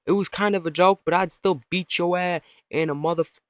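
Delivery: slightly high mock dog threat; lightly voiced cursing dog; swearing dog. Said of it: "you can tell that dog's swearing".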